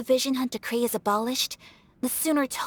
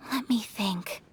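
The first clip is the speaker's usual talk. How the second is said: whispered musing; 2 semitones lower, 4 dB quieter, slower than usual. Acted. Pondering aloud.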